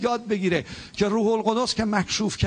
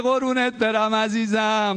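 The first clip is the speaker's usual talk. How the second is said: tender heavenly Father voice; 3 semitones higher, slower than usual. Pretend. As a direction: coo it slowly like a loving father to his child. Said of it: "نه به این صدا".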